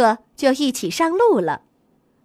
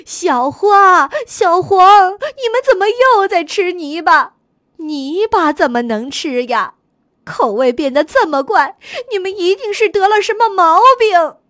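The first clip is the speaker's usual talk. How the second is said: louder and higher, calling out in alarm; anxious cry; 5 semitones higher, 5 dB louder than usual. A narrator's reading. Cow mother calling her calves, loud and alarmed. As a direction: cry out to the calves, frantic with worry. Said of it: "急得叫了起来".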